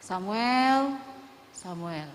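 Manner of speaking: voice calling Samuel. Retold or imitated. God calling little Samuel at night, drawn-out then hushed. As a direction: imitate a distant call, second one hushed. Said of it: "saya kayak".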